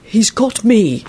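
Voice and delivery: slow, resolute reply; steely, measured assurance. A narrator's reading. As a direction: answer with deliberate firmness. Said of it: "replied in a steely voice".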